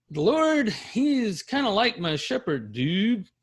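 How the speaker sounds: high, loose surfer-dude paraphrase; casual dude voice. Shown as a demonstration. Teacher mocking a casual simile version for class, dude voice. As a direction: toss it off like a slacker. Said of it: "Do you see the difference?".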